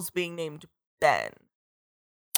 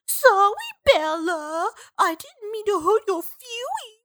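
high, slow mock whiny apology; annoying Jacob; 11 semitones higher, slower than usual. Retelling how Jacob apologises to Bella, in a mocking high whine. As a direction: ham up a much higher, syrupy sorry. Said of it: "Jacob does his annoying".

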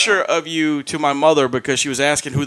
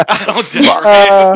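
drawn-out, louder mock echo; 6 dB louder, slower than usual. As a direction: parrot it back, slow and loud. Mockingly echoes the other man's line.

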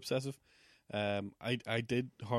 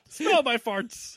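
teasing mock taunt; 10 semitones higher, 7 dB louder, much slower than usual. Teasing mock line, slow, high.

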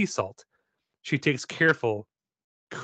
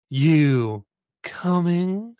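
slow, drawn-out beckoning call; eerie beckoning; 5 semitones higher, 4 dB louder, much slower than usual. Acted. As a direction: draw it out, luring. [name] beckons eerily.

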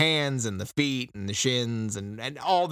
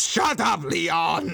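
higher, louder shut up; a tyrant turning on his aide; mock furious snap; 5 semitones higher, 4 dB louder, much slower than usual. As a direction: bark it at the underling. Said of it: "spins on him and just goes".

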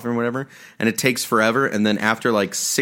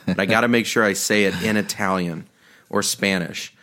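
usual speaking voice

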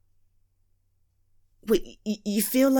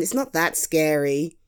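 ordinary talk

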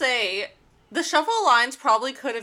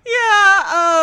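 laughing; 9 semitones higher, 8 dB louder than usual. Real, not acted. Laughing reaction.

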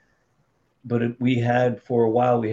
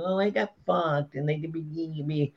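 higher-pitched, put-on whine; mock whining; 4 semitones higher, 6 dB quieter than usual. Producer mocking complaints about Hollywood, mock whining. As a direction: whine it like a self-pitying victim. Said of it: "sit here and whine and go".

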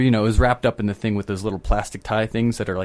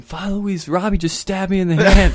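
higher, mock panicked yell to the priest; retold scream; 8 semitones higher, 4 dB louder than usual. To Father Luis after stabbing his hand, mock scream. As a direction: call out like you've just been stabbed. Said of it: "I screamed, I'm like".

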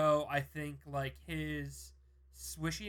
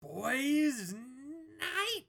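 mock boys' night; 10 semitones higher than usual. Mock drag of phrase.

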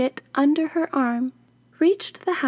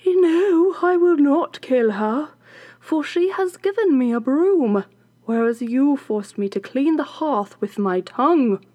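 defiant servant woman; 3 semitones higher, 4 dB louder than usual. Acted. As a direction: dig your heels in, won't budge. Kitchen woman refuses witch.

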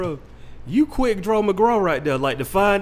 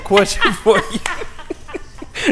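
slow drawn-out quote; 5 semitones higher, much slower than usual. Retold or imitated. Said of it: "like, "Yo".

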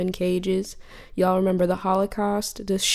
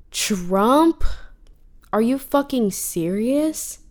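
high, loud and incredulous; can't believe it's real, let it burst out; stunned, exasperated outcry; outraged disbelief; 5 semitones higher, 6 dB louder, much slower than usual. Real, not acted. Outraged at Trump's election.

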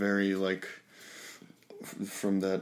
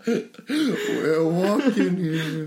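very slow, higher and louder; New York tough guy; 7 semitones higher, 4 dB louder, much slower than usual. Pretend. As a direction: drag it out like a street tough. Echoing the movie quote.

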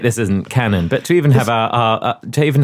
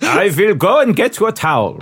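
put-on German accent, higher pitched; 5 semitones higher than usual. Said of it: "my German accent's been very good".

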